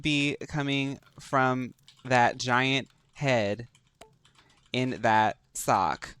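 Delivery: slow, deliberate put-down; sassy shade. Pretend. Player mocking a rival wizard's hat, slow sassy shade. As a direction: draw the insult out phrase by phrase.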